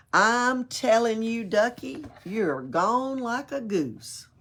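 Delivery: plain reading voice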